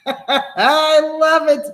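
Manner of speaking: high, loud laughing shout. Real, not acted. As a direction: crack up and yell it out slow. Laughing shout while watching.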